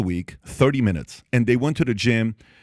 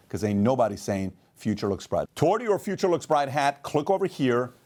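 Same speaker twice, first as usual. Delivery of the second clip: softer promo read for the hat; 4 dB quieter than usual. Acted. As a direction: pitch the merch like a closing ad. Closing merch ad read.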